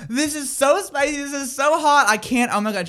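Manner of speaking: high, hammed-up whine of distress; mock distressed friend. Friend complaining about spicy food.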